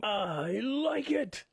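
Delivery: very slow, stretched-out catchphrase; RoboCop movie quote. Retold or imitated. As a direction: draw every word out in a put-on voice. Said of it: "do a quote from RoboCop".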